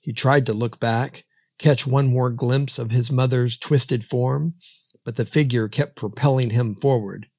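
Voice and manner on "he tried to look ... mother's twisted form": usual speaking voice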